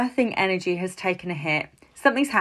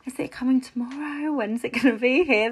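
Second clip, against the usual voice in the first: wondering inner voice; 6 semitones higher than usual. Retold or imitated. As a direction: muse aloud like an impatient mum-to-be. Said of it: "and you're thinking".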